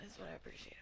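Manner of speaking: hushed whisper. Real, not acted. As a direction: whisper it close as an aside. Whispered joking aside.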